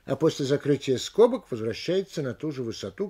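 ordinary talk

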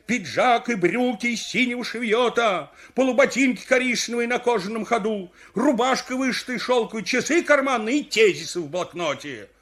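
high, insistent inventory; indignant listing. Acted. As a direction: rattle off your losses in protest. Victim indignantly lists stolen clothes.

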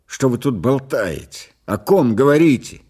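exasperated questioning that builds; agitated demand. A narrator's reading. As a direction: demand an answer, rising in heat. Patient demands to know who is meant.

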